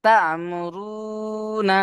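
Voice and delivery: তা'মুরুনা chanted slowly, held on a high tone; তা'মুরুনা intoned as in recitation, with রু stretched one alif; Quranic recitation. Shown as a demonstration. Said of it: "চলেন, ওইভাবে".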